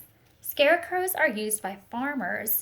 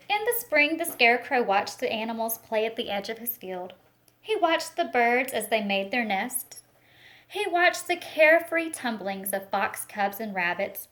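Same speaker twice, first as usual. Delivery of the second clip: put-on expressive picture-book reading for children; storytelling read-aloud; 5 dB louder than usual. Teacher reading a picture book to the class, storytelling voice. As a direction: paint the story for a class of little ones.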